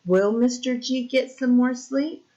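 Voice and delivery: ordinary delivery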